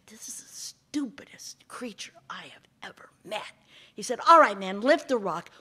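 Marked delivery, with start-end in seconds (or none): 0.1-3.5 breathy hushed aside; whispered aside. Acted. Snake aside to man. 4.3-5.5 louder, bossy; loud order. Acted. Snake orders man.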